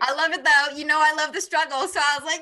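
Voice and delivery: high, loud delight; gleeful. Real, not acted. Teasing friend who is struggling with the book, gleeful. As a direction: gush it, on the edge of laughter.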